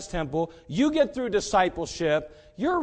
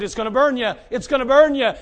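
preached warning; 5 semitones higher, 10 dB louder than usual. Retold or imitated. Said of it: "and God said".

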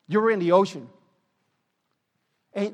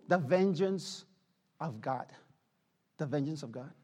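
hushed solemn answer; 5 semitones lower, 8 dB quieter than usual. Real, not acted. Answer repeated for weight.

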